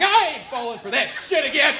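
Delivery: high, loud defensive yell; hammed-up outburst of refusal; snapping back. Comic refusing anyone who offers a cookie, snapping loudly. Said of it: "I just snap back at 'em like".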